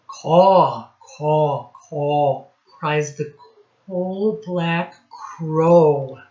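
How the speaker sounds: lively read-aloud, sound words popped out; reading to children. Acted. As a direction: read it to a class of little kids, playing up the noises. Teacher reads rhyming picture book.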